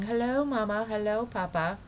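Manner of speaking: lightly voiced dismissive mimic of the greeting; wife mocking radio greetings; mocking the explorers. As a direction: wave the silly greeting away. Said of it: "a lot of men ... saying".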